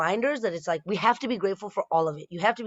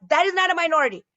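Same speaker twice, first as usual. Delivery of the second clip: indignant outburst; 11 semitones higher, 5 dB louder, much faster than usual. Acted.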